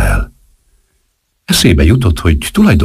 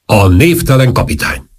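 raised-pitch ceremonial announcement; loud announcement; 6 semitones higher than usual. A narrator's reading. Major announcing the captain to the conspirators, loud announcement. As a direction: announce the arrival to the room. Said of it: "és hangosan mondta".

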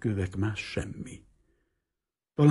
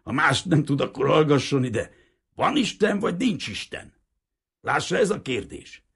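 put-on higher, louder gruff old man lecturing; a higher, louder hint of a defiant old man; stubborn old fisherman; 7 semitones higher, 4 dB louder than usual. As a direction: press the question on the priest like a grumbling old fisherman. Old man's long speech.

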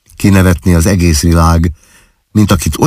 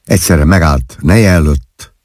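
plain reading voice

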